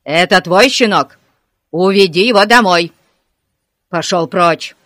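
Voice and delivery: loud, enraged policeman; furious shout. A narrator's reading. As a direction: shout in fury as you shove the pup away. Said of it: "рассвирепел ... он крикнул". (яростный крик)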